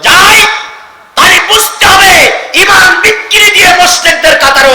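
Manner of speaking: high, loud preaching cry; shouted warning. Real, not acted. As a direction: burst out in a loud sermon warning. (গলা চড়িয়ে হুঁশিয়ারির হাঁক)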